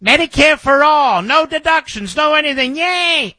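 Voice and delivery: high-pitched mock cheer of a naive supporter; sarcastic high cheerleading, ending on a yay. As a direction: chant it giddily, mocking the crowd. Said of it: "when people talk like this".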